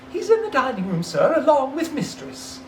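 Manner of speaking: high, polite maid's voice; young maid. Acted. A maid telling Scrooge where her master is, polite. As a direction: answer brightly as a housemaid.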